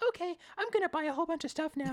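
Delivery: mock ditzy girl, pitched high; careless girl. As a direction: sing it out, carefree and oblivious. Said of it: "She's like".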